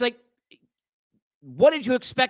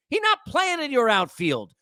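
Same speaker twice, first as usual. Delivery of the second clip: usual speaking voice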